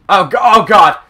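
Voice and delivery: loud, high panicked outburst; real in-game panic. Real, not acted. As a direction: stumble over a cry of alarm. Panics under attack in game.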